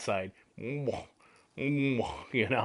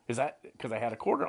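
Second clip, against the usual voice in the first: ordinary talk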